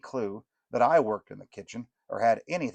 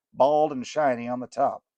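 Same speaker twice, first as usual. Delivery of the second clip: ordinary delivery, nothing marked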